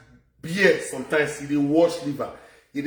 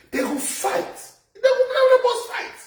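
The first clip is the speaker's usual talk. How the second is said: squealing with laughter, much higher; high-pitched giggling boast; laughing; 3 dB louder, slower than usual. Real, not acted.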